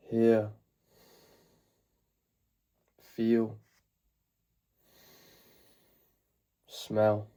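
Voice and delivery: calm meditation guide, long pauses between phrases; guided meditation. Acted. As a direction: ease them into the present moment, unhurried.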